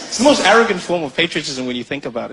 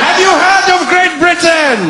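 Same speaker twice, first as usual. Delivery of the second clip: high, loud booming question; loud high herald question; pompous shout; 13 semitones higher, 7 dB louder than usual. Acted. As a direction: boom it like a herald. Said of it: "you don't need to shout".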